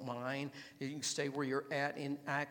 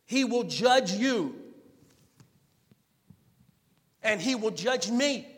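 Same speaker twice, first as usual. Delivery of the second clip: high, loud, driving preacher cry; full-force preaching; 6 semitones higher, 12 dB louder than usual. Acted. Preacher urging his church at full force, loud and high. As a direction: thunder it out to the congregation.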